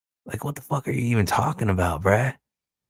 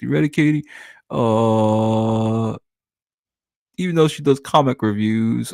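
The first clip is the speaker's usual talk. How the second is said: higher, put-on sneering voice; mocking impression of Dark Grift; 3 semitones higher than usual. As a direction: parody him as a whiny hater, pitched up. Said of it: "you're saying".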